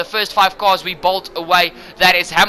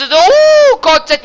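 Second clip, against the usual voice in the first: drawn-out startled oh, much higher and louder; sudden alarm; 12 semitones higher, 8 dB louder, much slower than usual. Real, not acted. Commentator sees two cars collide, alarmed oh. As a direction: react to a crash as it happens.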